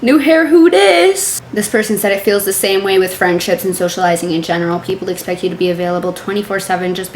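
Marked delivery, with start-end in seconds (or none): 0.0-1.4 high, sassy "new hair, who dis"; mock sassy meme voice. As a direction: toss it off like a diva. Mocking meme catchphrase.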